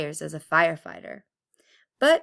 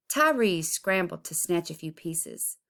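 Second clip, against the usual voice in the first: usual speaking voice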